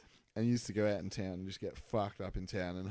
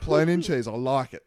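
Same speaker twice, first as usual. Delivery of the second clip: higher, louder hammed-up answer; loud mock brother voice; 5 semitones higher, 13 dB louder than usual. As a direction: play the brother, half laughing.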